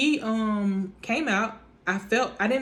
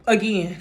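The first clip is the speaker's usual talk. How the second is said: imitated gasp; 6 dB louder than usual. Out of breath after pushing.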